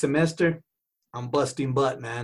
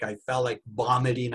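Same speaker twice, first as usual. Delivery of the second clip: ordinary talk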